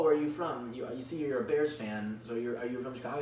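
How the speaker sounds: usual speaking voice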